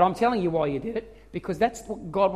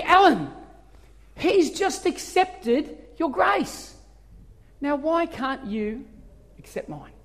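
gentle voice of God; 6 semitones higher, slower than usual. Retold or imitated. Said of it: "the Holy Spirit spoke to me".

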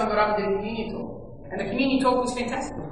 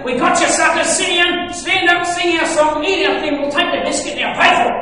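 loud, higher, rapid-list commands; mock bossy orders; 5 semitones higher, 6 dB louder than usual. Church as newcomers imagine it, mock bossy orders. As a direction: order them about like a hammed-up swindler.

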